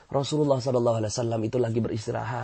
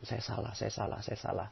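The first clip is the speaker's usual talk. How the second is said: very soft murmured admission; soft confession; 3 semitones lower, 15 dB quieter than usual. Shown as a demonstration. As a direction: murmur it inwardly. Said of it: "Jadikan cermin ajaib".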